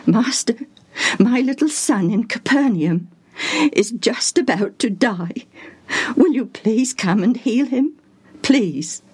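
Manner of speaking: hushed, trembling plea to the master; voice quivering. A narrator's reading. Desperate father begs Jesus to heal his son, quivering. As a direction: beg through tears, barely voiced. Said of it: "his voice quivering with emotion".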